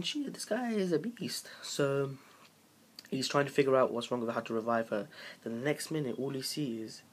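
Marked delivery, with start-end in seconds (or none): 0.0-1.5 high, slow awestruck exclamation; drawn-out high-pitched amazement; amazed reaction. Retold or imitated. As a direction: stretch it out high, marvelling at him. Acting out his amazed thought.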